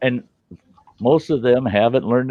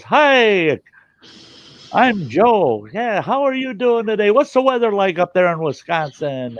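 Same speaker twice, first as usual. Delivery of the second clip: put-on high, chirpy friendly young call-center voice; young guy on the phone; 11 semitones higher than usual. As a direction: play the overly cheerful young phone agent. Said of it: "young guy on there that goes".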